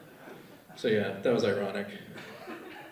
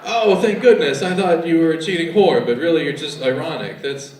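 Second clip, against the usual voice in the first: louder, higher sarcastic relief; mock relieved boyfriend; 4 semitones higher, 7 dB louder than usual. Boyfriend hearing the news, sarcastic relief. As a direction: gush with fake relief. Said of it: "call your boyfriend up".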